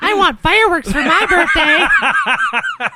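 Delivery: high, loud, drawn-out birthday demand; mock a spoiled kid demanding fireworks; hammed-up childish plea with laughter; mock needy child. Mocks the needy July-fourth birthday child.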